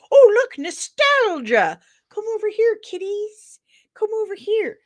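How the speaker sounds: high, sing-song coaxing voice; hammed-up high-pitched enticer; luring kiddies with treats. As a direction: coax the kiddies over with candy. Voices studios luring fans with nostalgia.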